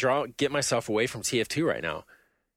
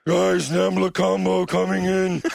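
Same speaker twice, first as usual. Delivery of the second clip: higher, louder, thick strangled fat-guy voice; pork chop stuck in the larynx voice; 6 semitones higher, 5 dB louder, slower than usual. Acted. Gamer doing a silly fat-man voice over team chat, strangled and loud. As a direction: talk like meat is lodged in your throat. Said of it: "pork chop stuck in the larynx voice".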